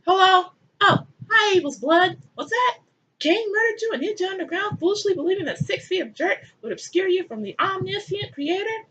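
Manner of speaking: high, theatrical phone chatter; hammed-up high-pitched deity; mock God on phone. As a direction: play a fake phone call to the audience.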